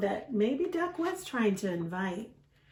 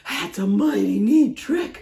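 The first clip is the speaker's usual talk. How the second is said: louder, gruff-styled folksy voice; impressed dog; 7 dB louder than usual. Acted. Dog admiring Duck's trick, louder character voice. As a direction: admire it like a laid-back hound. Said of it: "we have to use the voices".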